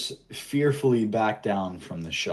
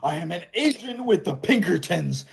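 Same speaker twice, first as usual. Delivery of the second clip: a cornered lawman shrieking for help; high panicked Pinkerton voice; frantic wounded agent; 10 semitones higher than usual. Acted.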